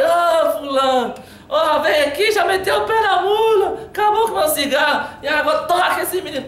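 put-on upset old man hollering for the boy's dad; calling out. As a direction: shout for the father across the corral. Grandpa calling the boy's father.

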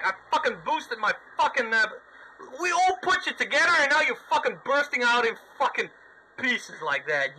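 ordinary talk, nothing marked

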